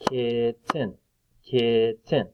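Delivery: "kitten" in two separate beats; split into syllables. Shown as a demonstration. Teacher clapping syllables for children, "kitten" split in two. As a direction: break "kitten" apart, kit, ten. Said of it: "clap out the different parts".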